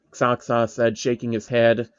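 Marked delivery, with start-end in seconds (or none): none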